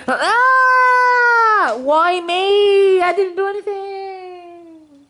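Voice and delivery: high-pitched drawn-out wail; scream, then whine it out very high; wailing protest. Acted. Peach hauled off by security, wailing protest.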